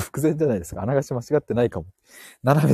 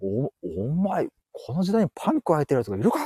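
startled middle-aged man; 2 semitones higher, 5 dB quieter than usual. Acted. Man scolding the runner he bumped into, mock indignant. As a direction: play a flustered stranger, softer than a real yell. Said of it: "普通おっさんとかとぶつかって".

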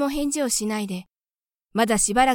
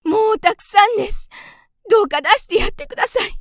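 much higher, louder, desperate plea; can't bear it; 11 semitones higher, 8 dB louder than usual. A narrator's reading. Mother mouse begging Gauche to let her child out, loud and high. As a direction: burst out begging him to stop. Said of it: "とうとうこらえきれなくなった風で".

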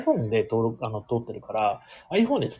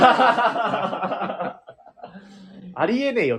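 high-pitched comic objection; jokey, incredulous put-down, pitched up high; mock tsukkomi retort; 8 semitones higher, much slower than usual. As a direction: throw it out as a playful comeback. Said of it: "とかで突っ込んでくれてもいいんですよ".

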